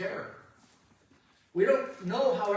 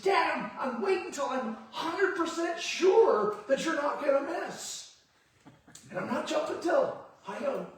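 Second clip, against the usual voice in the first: put-on high, raised kid voice; boy telling dad he won't jump yet; stubborn little boy; 8 semitones higher, 3 dB louder than usual.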